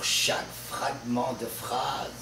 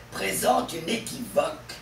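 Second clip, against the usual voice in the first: ordinary talk, nothing marked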